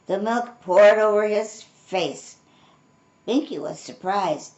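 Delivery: plain reading voice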